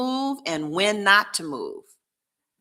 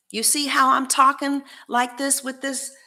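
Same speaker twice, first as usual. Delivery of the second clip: a lifted, raised voice under candle light; noticeably higher, lighter voice; higher talking voice; 8 semitones higher than usual. Real, not acted. Showing viewers how the candle changed her voice, higher. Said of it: "Do I ever talk like this?".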